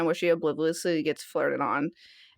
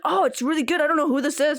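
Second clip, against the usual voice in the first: high, retold enthusiasm; animated driver; 7 semitones higher than usual. Driver hearing passengers' song, animated. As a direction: imitate an animated music listener. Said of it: "He was so animated".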